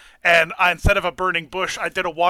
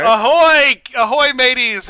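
loud, high pirate hail; pirate greeting; 6 semitones higher, 9 dB louder, slower than usual. Pretend. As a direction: call out like a pirate captain. Pirate-style stream greeting.

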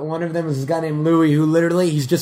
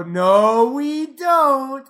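higher mock denial; crowd playing dumb; 9 semitones higher, 5 dB louder, much slower than usual. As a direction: pretend not to know. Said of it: "they go".

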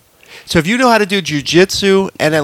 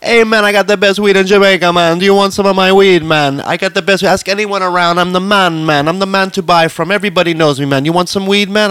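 higher, put-on Jamaican hustler patter; horrible Jamaican accent; 4 semitones higher than usual. Dealer pitching to a tourist, badly imitated Jamaican accent. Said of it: "excuse me for the horrible Jamaican accent".

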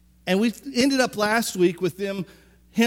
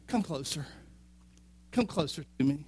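much softer and lower, gentle; soft invitation; 6 semitones lower, 10 dB quieter than usual. Retold or imitated. Joseph gently calls his stunned brothers closer, soft and low. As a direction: coax them in quietly.

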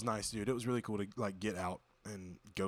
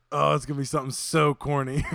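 laughing; 5 semitones higher, 10 dB louder than usual. Real, not acted. Laughing, expecting a corny joke.

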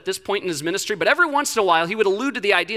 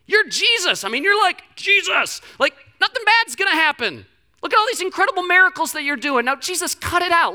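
mock scolding; 9 semitones higher than usual. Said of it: "he really starts letting Jesus have it".

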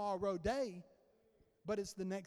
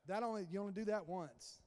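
ordinary talk

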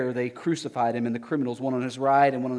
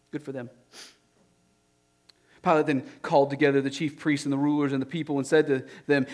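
ordinary delivery

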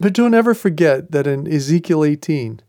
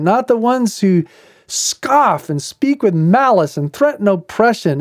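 much higher, pleading outcry; indignant protest; 9 semitones higher than usual. Retold or imitated. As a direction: protest to God that it isn't fair. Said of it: "'cause he's like".

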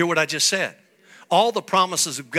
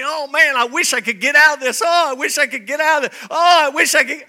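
a lightly voiced whining believer, higher and faster; whiny complainer; 8 semitones higher, 5 dB louder than usual. Pastor mocking a self-pitying believer, whining. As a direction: whine "I wish I could get out of this" higher and fast. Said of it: "Don't get way back here and say".

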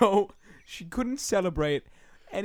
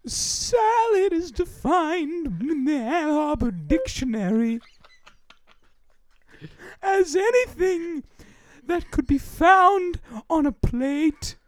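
high, slow ghostly wail; 11 semitones higher, 3 dB louder than usual. Acted. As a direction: drift it out like a phantom. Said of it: "ghostly voice".

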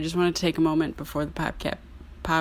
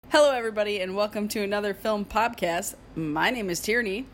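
bright, higher podcast welcome; host intro; 4 semitones higher than usual. Acted. Host greeting listeners at the show's start, upbeat intro. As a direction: open the show like a host.

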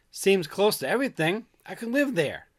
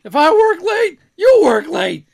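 loud mock boss, higher pitched; mock bossy boast; 12 semitones higher, 12 dB louder, slower than usual. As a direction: bark it like a smug manager. Mock office boss speech.

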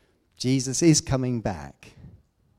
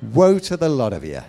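laughing; 4 semitones higher, 9 dB louder, faster than usual. Real, not acted. Preacher laughing through the woe.

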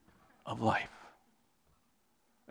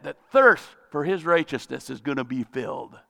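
ordinary talk, nothing marked

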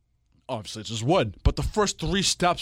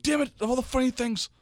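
high-pitched mock frustration; imitating girlfriend; 12 semitones higher than usual. Girlfriend regretting the missed video, mock exasperation. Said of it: "she was like".

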